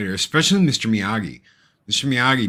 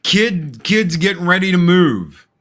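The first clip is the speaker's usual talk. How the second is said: ordinary talk, nothing marked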